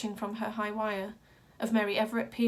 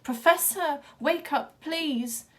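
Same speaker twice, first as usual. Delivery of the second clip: higher, louder desperate plea; crying out; 7 semitones higher, 6 dB louder, slower than usual. A narrator's reading. Wild Boy begging the dying professor, crying out desperately. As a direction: beg him to wake up. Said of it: "crying out".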